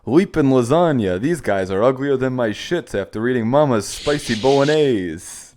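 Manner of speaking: loud hammed-up plumber; Italian plumber voice. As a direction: shout it like a cartoon plumber. Luigi character line.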